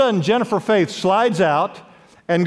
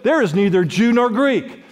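preached scripture; 4 semitones higher than usual. Acted. Preacher quoting Paul to the congregation, emphatic scripture proclamation. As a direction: proclaim the verse, stretching the last line.

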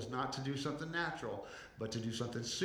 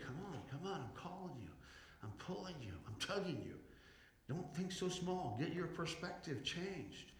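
soft, higher-pitched coaxing; God's gentle urging; 4 semitones higher, 8 dB quieter than usual. Acted. God calling and pulling believers onward, softly urging. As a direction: coax them on in a hushed, lifted voice. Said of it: "And God's saying".

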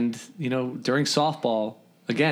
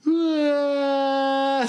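imitated cry; 13 semitones higher than usual. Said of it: "and looks up at us and just goes".

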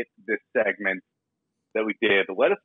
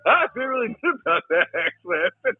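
laughing; 10 semitones higher than usual. Real, not acted. Contestant giggles through answer.

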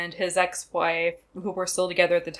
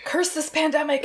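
mock dramatic curse; 8 semitones higher than usual. Mock lament about no salami.

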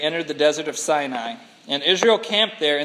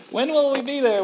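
put-on whiny kid; 8 semitones higher than usual. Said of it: "road trip".

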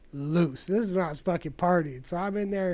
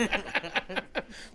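laughing hard; 5 dB quieter than usual. Real, not acted. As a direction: crack up at your own story. Laughing at own story.

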